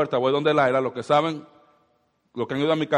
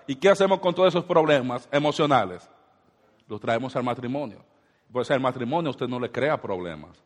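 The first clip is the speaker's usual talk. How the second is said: usual speaking voice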